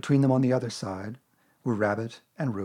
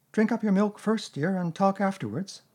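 high-pitched motherly instruction; mother Kanga; 9 semitones higher, 4 dB louder than usual. Acted. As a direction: gently chivvy a little child. Kanga instructs her child Roo.

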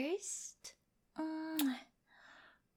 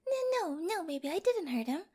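hasty denial; 3 semitones higher than usual. Acted. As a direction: rush to deny it, pitch jumping up. Hastily denying harm.

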